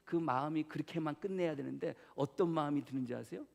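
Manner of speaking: ordinary delivery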